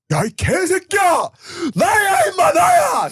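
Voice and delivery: full-blast, high-pitched imitated shouting; angry Korean yelling. Imitating an old man at a restaurant, yelling at his friend. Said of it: "he's yelling at his friend".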